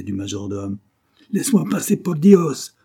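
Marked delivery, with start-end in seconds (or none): 1.3-2.7 high, loud outburst; frantic, exclaimed plea; exasperated demand. A narrator's reading. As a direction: cry it out to get past. Majordomo exasperated at being blocked.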